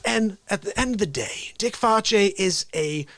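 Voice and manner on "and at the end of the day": ordinary talk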